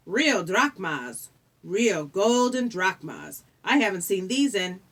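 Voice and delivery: very slow, drawn-out wonder; savouring awe. Acted. The ferryman hovers over the golden coins, slowly savouring them. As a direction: linger greedily over every word.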